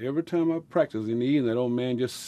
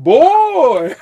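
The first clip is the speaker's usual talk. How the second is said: put-on much higher, loud amazed "Boy"; father's amazed shout; 15 dB louder than usual. Father hears the ten-dollar offer and shouts in amazement. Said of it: "He said".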